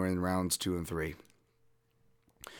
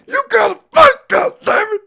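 imitating a yelling dad; 16 dB louder than usual. Imitating the dad yelling from the corner, mock scream. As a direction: bellow at your son like a furious corner dad. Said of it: "you just hear his dad go".

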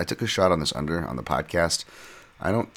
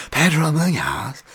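high, drawn-out, badly faked Bisping accent; terrible Bisping accent; 11 semitones higher, 4 dB louder, slower than usual. Podcaster imitating Bisping calling fights, terrible Bisping accent. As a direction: stretch the name in a bad Bisping accent, pitched way up. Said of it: "I know it's terrible Bisping".

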